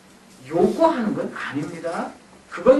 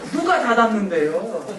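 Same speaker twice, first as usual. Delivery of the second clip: ordinary delivery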